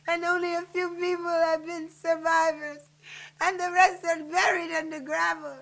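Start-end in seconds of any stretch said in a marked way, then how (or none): none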